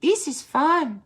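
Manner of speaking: little sister kitten at the yard; tiny sister voice. Acted.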